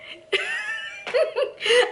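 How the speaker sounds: high squealing burst of laughter. Real, not acted. Vlogger amused by her Psycho Shelly thought, high laugh.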